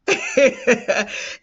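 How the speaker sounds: laugh. Real, not acted. Mom laughing at her kids' suspicion, short laugh.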